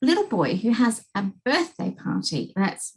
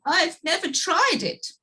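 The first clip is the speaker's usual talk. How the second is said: sniffling; 8 semitones higher than usual. Acted. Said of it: "sniffed the king".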